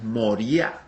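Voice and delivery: "Moriah" with a clearly stressed, rising ending; "Moriah" with the weight late in the word; Hebrew accented Moriah. Shown as a demonstration. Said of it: "en el hebreo lleva tilde".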